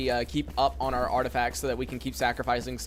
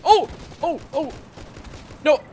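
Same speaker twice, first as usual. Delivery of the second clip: sudden startled shouts; startled cry; 12 semitones higher, 5 dB louder than usual. Real, not acted. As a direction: jump at the fright. Sudden jump scare in game.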